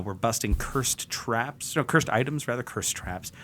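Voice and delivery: normal talking voice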